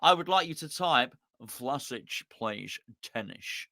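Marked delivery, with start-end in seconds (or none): 1.4-3.7 lower, much softer, slow Scottish slur on "Vlasic plays tennis" with lisped S; Sean Connery impression. Telling the chat to type it the Connery way, as a low slow Scottish slur. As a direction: do a hushed Scottish Connery, every S turned to SH. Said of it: "in a style of Sean Connery".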